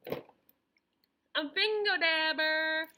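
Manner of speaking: slow, loud drawn-out reveal; excited reveal. Real, not acted. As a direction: stretch it out like a surprise. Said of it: "excited show-and-tell reveal".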